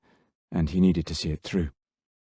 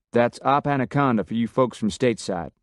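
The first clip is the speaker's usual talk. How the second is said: brisk, raised Texan briefing voice; a lightly voiced Texan officer taking charge; Texan commander; 4 semitones higher than usual. A narrator's reading. Miller briefing the special ops team, brisk Texan voice.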